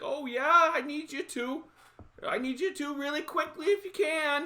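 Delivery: put-on high-pitched, polite old-lady voice. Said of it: "She's like".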